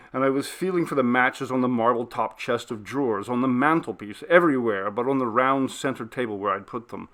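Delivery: ordinary delivery, nothing marked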